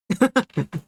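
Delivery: short, high chuckle; delighted laugh. Real, not acted. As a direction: burst into a brief giggle. Delighted by the posted art.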